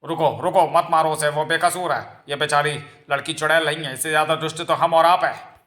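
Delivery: urgent defending plea. Acted. Father stopping villagers stoning an innocent girl, urgent plea. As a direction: call a halt, then protest with force.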